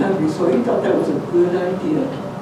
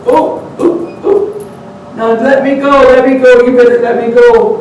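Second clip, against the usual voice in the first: high, loud frantic begging; panicked pleading; 5 semitones higher, 5 dB louder than usual. Acted. Stuck creature begging the man to release it, panicked. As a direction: squeal to be let go.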